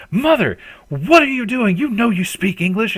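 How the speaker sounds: high, loud, exasperated scolding; angry daughter. Acted. Daughter yelling at her mother in Japanese, high and loud. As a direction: snap at your mother for playing dumb. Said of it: "absolutely screaming".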